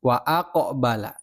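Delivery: "Wa aqbala" with the q clearly rebounding; bouncing qaf. Shown as a demonstration. Teacher repeats the correct reading to pupils, bouncing. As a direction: give the qaf in "aqbala" a small echo. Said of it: "Jadi bacanya memantul".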